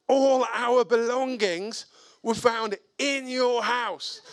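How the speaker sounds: high, drawn-out confrontation; retold exasperated challenge; indignant accusation. Confronts neighbour with the evidence.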